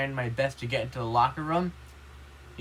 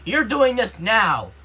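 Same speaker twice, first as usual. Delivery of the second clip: loud, high, slow and emphatic; teacher's yelled order; 6 semitones higher, 8 dB louder, slower than usual. Retold or imitated. Gym teacher forcing an unwilling student into a game, slow angry yell. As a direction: drag out each word like a furious coach. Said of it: "He was like".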